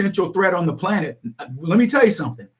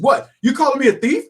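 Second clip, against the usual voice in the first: mock-offended, higher-pitched retort; indignant roommate impression; defensive; 7 semitones higher than usual. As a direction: act affronted at the accusation. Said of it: "And then he gets defensive".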